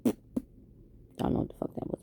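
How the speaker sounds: ordinary talk, nothing marked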